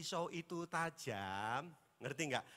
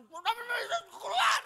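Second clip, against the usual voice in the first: much higher, shouted exorcism command; mock exorcist shout; 10 dB louder, slower than usual. Pastor mimicking friend casting out demon, hammed-up shout. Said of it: "Saya pernah lihat teman saya ngusir setan".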